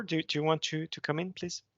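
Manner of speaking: normal talking voice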